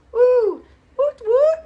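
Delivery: high, loud woot woot; squealing stadium cheer; mock cheering. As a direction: cheer like a fan in the stands. Cheering on winning athletes.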